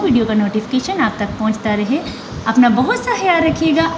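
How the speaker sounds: bright, loud outro pitch to viewers; loud vlog sign-off. Real, not acted. Host cheering the finished dal to viewers, loud. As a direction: proclaim the finished dish loudly.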